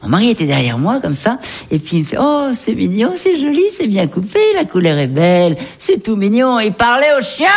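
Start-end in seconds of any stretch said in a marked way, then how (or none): 2.2-6.6 high, doting coo; imitated cooing. Husband admiring the freshly groomed dog, high cooing imitation. 6.6-7.5 high-pitched, exasperated shout; outraged yell. Acted. Wife revealing whom her husband admired, high outraged shout. Indignant punchline about the husband.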